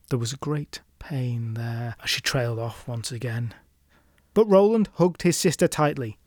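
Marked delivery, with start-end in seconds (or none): 0.1-3.5 whispered, much softer narration; hushed narration. A narrator's reading. Narrator telling of her pain, whispered. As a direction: hush the sad moment.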